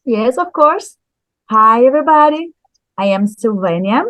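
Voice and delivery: usual speaking voice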